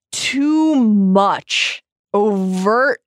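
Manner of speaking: TOO MUCH stretched out very slowly; drawn-out emphasis. Real, not acted. Host criticising heavy exposition, "too much" slowly drawn out. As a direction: weigh each word, "too ... much ... overt". Stressing what bad stories do.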